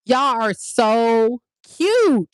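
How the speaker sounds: high, teasing "y'all make me sick"; playful mock disgust. Friend teasing a cute couple, mock disgusted. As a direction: tease the lovebirds with fake disgust. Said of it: "I'm like".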